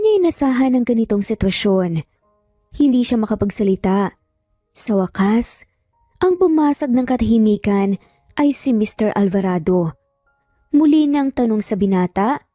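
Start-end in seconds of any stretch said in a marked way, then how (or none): none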